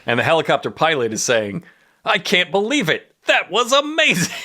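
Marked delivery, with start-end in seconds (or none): 2.0-4.3 high, awestruck mock excitement; all for it. Helicopter pilot thrilled by the stunt, hammed-up amazement. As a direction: act genuinely blown away, voice leaping up. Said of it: "he's all for it now".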